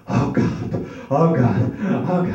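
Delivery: moans building from breathy to loud; father moaning. Retold or imitated. Father upstairs moaning during sex, building mock moan. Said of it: "he heard my father in the back going".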